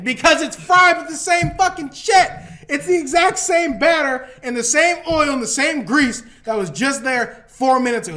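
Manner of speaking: loud, high comic outrage; exasperated rant. Real, not acted. Comic arguing that batter hides the taste, loud exasperated rant. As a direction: rail about it at full volume.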